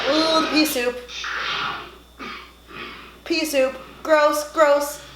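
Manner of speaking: high grossed-out groan; disgusted. Real, not acted. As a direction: recoil in disgust. Disgusted at pea soup scene.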